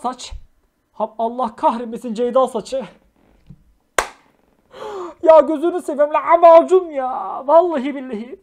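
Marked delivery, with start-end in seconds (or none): 5.2-8.4 exasperated outburst. Real, not acted. Exasperated at the photo edits.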